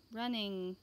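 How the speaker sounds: ordinary delivery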